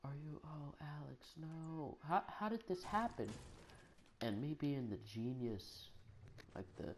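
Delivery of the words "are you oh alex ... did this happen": high, hushed mock-concerned coach voice; imitated coach in dismayed, soft high tones; worried coach. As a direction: softly lament over an injured kid, high-pitched. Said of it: "He starts like".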